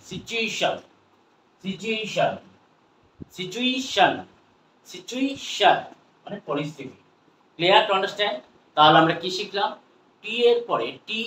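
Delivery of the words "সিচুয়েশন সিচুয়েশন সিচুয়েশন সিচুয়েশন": সিচুয়েশন with a raised, stretched middle syllable; pronunciation drill. Shown as a demonstration. Teacher drills viewers on the corrected word, careful pronunciation. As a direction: repeat সিচুয়েশন separately, long "ei" before "shan". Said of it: "এটা কি হবে?".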